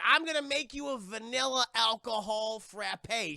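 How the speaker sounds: lightly acted grand drink announcement; lightly voiced boyfriend. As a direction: announce it slowly and proudly in a mock character voice.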